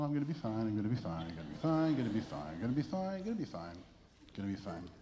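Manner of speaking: choked-up self-reassurance; getting emotional. Real, not acted. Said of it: "that's because I'm getting emotional".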